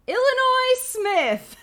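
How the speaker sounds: high, drawn-out shout; triumphant announcement. Acted. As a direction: announce the hero with a huge, hammed-up reveal. Grand reveal of film star.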